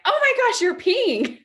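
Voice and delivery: loud, high yell; yelled out in sudden alarm; startled yell. Retold or imitated. Mother yelling at her toddler peeing on the floor, loud and high. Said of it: "I yell out of".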